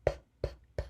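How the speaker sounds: whispered P p p sounds; isolated p sound. Shown as a demonstration. Teacher to kids, isolating the first sound. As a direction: tap out P as a breathy p. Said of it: "what's that first sound you hear?".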